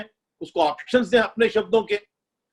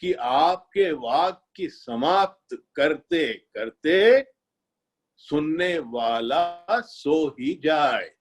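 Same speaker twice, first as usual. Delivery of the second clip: slow, dragging demo of over-slow speech; deliberately sluggish lecturing pace; too slow; 4 semitones lower, much slower than usual. Shown as a demonstration. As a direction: drag it out until the listener dozes. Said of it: "न इतना slow बोलना है".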